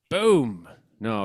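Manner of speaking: ordinary talk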